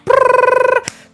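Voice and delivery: high-pitched rolled "brrr"; high trilled whirr. Retold or imitated. Describing stacks of winnings, high trilled noise. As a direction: trill it like money being counted.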